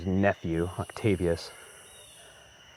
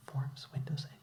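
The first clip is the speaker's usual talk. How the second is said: sudden whisper; 8 semitones higher, 12 dB quieter than usual. Retold or imitated. Reporter relaying the riot, suddenly whispering. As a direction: drop to a breathy whisper. Reading the mob's cries aloud.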